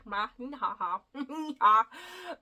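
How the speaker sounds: loud giggling burst; burst of laughter. Real, not acted. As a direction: laugh at your own mix-up. Laughing at stumbling on title.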